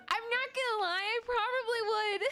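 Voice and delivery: high, loud, giggling confession; laughing admission. Real, not acted. Streamer laughing as she admits to chat she would.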